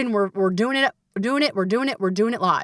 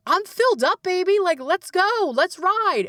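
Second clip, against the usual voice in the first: high, eager hype; rally a friend, ready to go; high, upbeat let's-go energy; filled-up friend; 11 semitones higher than usual. Retold or imitated. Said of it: "it was like".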